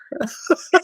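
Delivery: bright rising giggle; high giggle. Real, not acted. Laughs after quote.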